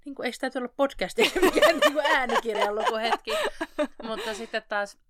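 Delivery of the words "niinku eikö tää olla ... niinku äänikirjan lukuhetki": laughing. Real, not acted. Teasing friend about reading voice.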